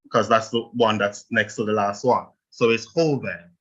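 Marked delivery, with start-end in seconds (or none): 2.9-3.5 joven with weight on the first syllable; stress on the O. Shown as a demonstration. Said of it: "You stress the O".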